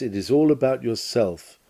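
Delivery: ordinary talk, nothing marked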